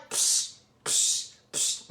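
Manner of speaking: voiceless "psh" puffs in a row; imitated monkey sound. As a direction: mimic monkeys with short pshing noises. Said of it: "the monkeys are like".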